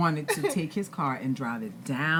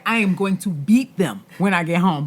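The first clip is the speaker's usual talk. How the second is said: louder, higher mock threat; half-joking angry mom threat; mad retold threat; 4 semitones higher, 9 dB louder than usual. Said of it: "I was like".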